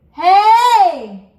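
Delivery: loud, high call from the mask; big hey. Shown as a demonstration. Coach repeating the belt for students, loud call. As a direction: throw it forward and big. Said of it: "let out a big hey right in front of your face".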